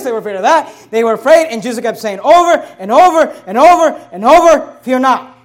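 loud, building preaching climax; preaching at full force. Acted. Pastor builds to Jesus's command, preaching at full force. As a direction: drive it up to the quote.

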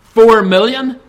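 usual speaking voice

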